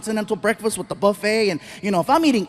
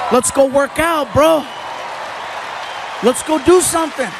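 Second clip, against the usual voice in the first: higher, pumped-up bro voice; hyped gym buddy; 3 semitones higher than usual. Retold or imitated. Friend urging him to the gym, a lightly voiced hyped bro. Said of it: "encouraging me to go to the gym".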